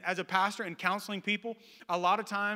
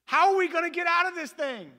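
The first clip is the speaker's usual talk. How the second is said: loud, high comic outburst; mock exasperated panic; 11 semitones higher, 8 dB louder than usual. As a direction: wail it in hammed-up dismay. Trapped man panics at rescuer.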